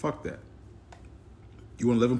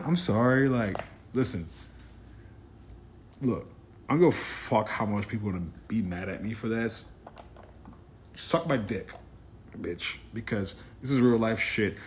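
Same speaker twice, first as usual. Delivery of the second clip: usual speaking voice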